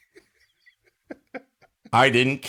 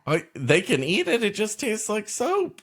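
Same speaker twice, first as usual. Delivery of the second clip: indignant rant about cilantro; exasperated disbelief, pitched up; incredulous outrage. Real, not acted. Mock outrage over cilantro.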